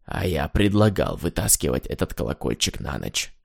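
low, soft grumble; grumbling dog. A narrator's reading. Dog grumbles to his owner, low and soft. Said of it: "проворчал".

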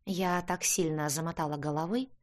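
plain reading voice

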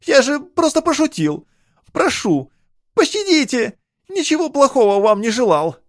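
high, loud panicked begging; pleading. A narrator's reading. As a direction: plead for your life, high-pitched. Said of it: "взмолился банник".

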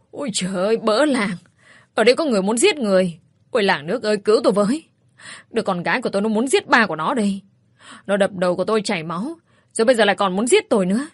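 hammed-up shouts of "help, murder"; shouting for help. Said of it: "chạy ra trước sân rồi la hét".

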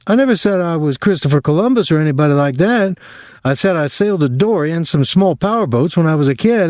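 a sailor protesting he never bragged; defensive Dwyer. A narrator's reading. Dwyer objects, voiced by narrator.

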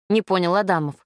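usual speaking voice

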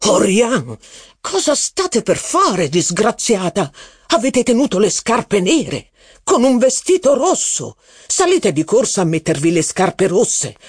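the duke scolds her, pitch jumping up high; terrible voice. A narrator's reading. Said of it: "esclamò con voce terribile".

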